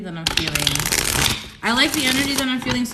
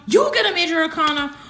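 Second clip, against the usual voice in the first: fast, higher and louder; the second louder call; louder excited; 7 semitones higher, 6 dB louder, much faster than usual. Real, not acted. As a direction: shout the news again, more thrilled.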